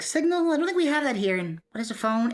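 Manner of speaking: high, puzzled character voice; put-on high questioning voice; Fountain. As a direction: play a baffled dragon, pitched well up. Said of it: "and Fountain's like".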